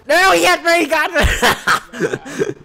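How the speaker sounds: high, loud yell breaking into laughter; panicked yell. Real, not acted. Player caught by opponent, panicked laughing yell. Gets beaten in the game.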